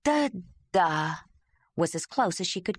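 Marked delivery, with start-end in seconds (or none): 0.0-1.2 "Da-da" as a clumsy strained attempt at "Jandalar"; effortful first try; struggling to copy. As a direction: mangle the name into "Da-da". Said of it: "strained to make her mouth work".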